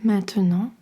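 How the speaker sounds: usual speaking voice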